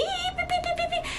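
high, soft bleeping sounds standing in for curses; bleep noises. Retold or imitated. Ex cursing her out, imitated as high soft bleeps. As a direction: mimic a censor beep, high and quick.

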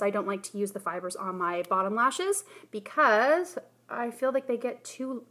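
normal talking voice

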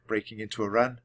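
plain reading voice

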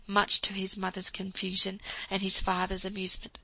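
ordinary delivery, nothing marked

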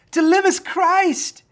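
"To live is Christ" drawn out, high and loud; slow proclamation. Acted. Preacher proclaiming the verse to his listeners, slow and loud. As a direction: lean on each word of "To live is Christ".

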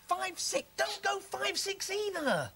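high, irritated telling-off; fed up, pitched right up; exasperated. Acted. Annoyed at interrupted test.

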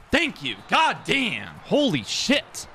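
high, loud exclamations, climbing; worked-up outburst. Real, not acted. Gamer after calling to knock it out, high worked-up exclamations.

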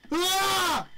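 scream. Real, not acted.

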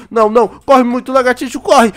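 loud panicked yelling; frantic shout. Real, not acted. To lagartixa while the owls chase them, frantic shout. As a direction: urge your friend to run, shouting.

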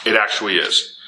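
ordinary talk

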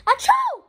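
high loud mock sneeze; hammed-up fake sneeze. Fake sneezing at his sister. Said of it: "fake sneeze".

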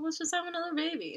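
high, quick, breezy mock offer; joking suggestion. A fan jokingly playing the heroine, breezy and high. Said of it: "I'd be like".

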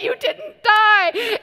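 joyful shout. Retold or imitated. Seeing her friend come out alive, a high joyful shout. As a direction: shout it out in relief. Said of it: "I just was like".

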